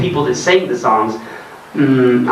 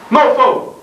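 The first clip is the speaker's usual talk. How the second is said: high mocking mofo; mocking slang; 11 semitones higher than usual. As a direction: toss out mofo in a high mock voice. Mutations of the cuss word.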